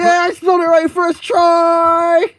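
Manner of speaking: high, loud, slow cheer; triumphant shout. Real, not acted. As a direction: crow over the win.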